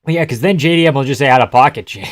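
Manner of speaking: ordinary talk, nothing marked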